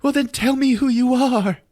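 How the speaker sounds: high-pitched panicked demand; hammed-up frightened woman, voice way up; mock panic. Julia to the thing on the floor, mock panic.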